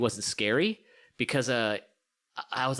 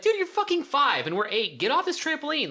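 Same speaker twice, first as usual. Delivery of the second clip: higher mock-annoyed kids; bratty kids; 13 semitones higher than usual. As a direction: boss the little kid off. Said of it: "we'd be like".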